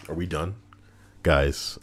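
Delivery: ordinary delivery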